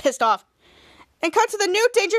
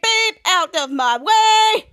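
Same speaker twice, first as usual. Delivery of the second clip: loud, higher call with long-held last word; 4 semitones higher, 6 dB louder, much slower than usual. Retold or imitated. Hero arriving through the crowd, loud drawn-out call. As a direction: honk it out, stretching the ending.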